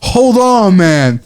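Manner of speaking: high, excited self-quote; mock amazement. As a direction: exclaim as if stopped by a revelation. Acted amazement at tasting fruit.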